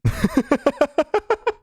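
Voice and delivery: high-pitched staccato laugh; a long run of high giggles. Real, not acted. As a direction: laugh high in quick, even pulses. Laughs after congratulating the band.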